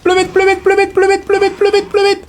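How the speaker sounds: frantic, very high-pitched rapid-fire repetition; comic panic. Real, not acted. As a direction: squeal it over and over as you flee. Panicking while fleeing in the game.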